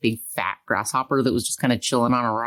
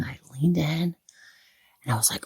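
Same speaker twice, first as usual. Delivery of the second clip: whisper; 3 semitones higher, 5 dB quieter than usual. Retold or imitated. Whispering while retelling leaning in.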